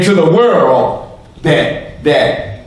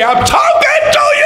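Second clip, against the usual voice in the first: mock shout. Shown as a demonstration. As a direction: belt it out as if God were yelling over the noise. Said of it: "God's not gonna shout over that".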